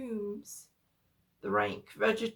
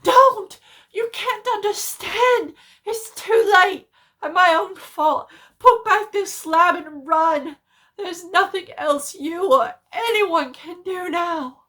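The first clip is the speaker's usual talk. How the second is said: high, loud desperate screaming; despairing scream; 8 semitones higher, 15 dB louder than usual. A narrator's reading. Warren screams to Carter from below, despairing. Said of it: "changed to a scream of utter despair".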